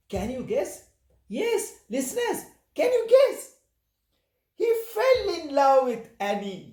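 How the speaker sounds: high-pitched excited reveal; playful suspense. Acted. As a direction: lift it high, coax them to guess. Teasing listeners before the reveal.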